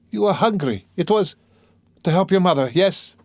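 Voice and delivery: put-on high, urgent young woman's plea; sudden cry. A narrator's reading. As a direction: cry it out, grasping for a reason. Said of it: "but suddenly she cried".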